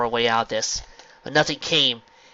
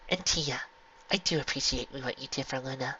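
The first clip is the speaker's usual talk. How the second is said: quiet, gentle mother; soft thanks; 9 dB quieter than usual. A narrator's reading. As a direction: thank her softly.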